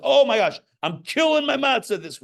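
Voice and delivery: high, loud mock enthusiasm; mock gushing. Rabbi mocking a proud, self-pleased mitzvah doer. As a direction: gush like a show-off.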